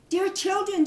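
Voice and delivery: put-on high fond call to the kids; mother goat. Mother goat calling her children before leaving.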